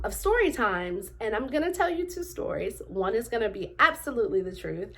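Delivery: ordinary talk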